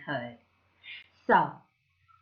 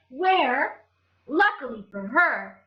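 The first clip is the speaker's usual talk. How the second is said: plain reading voice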